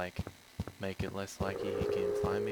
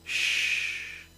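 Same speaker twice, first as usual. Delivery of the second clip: shushing whisper; 3 dB louder than usual. A narrator's reading. End of spooky story told.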